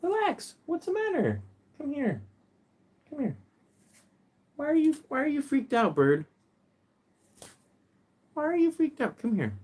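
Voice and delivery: very high coaxing voice; high soothing coo to a scared parrot; soothing pet talk. Real, not acted. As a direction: coax the frightened bird to come. Calming frightened bird, high voice.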